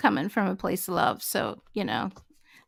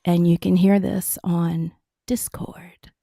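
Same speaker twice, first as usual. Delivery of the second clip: radio announcer voice; 2 semitones lower, 8 dB louder, slower than usual. Pretend. Announcer voice on new mic.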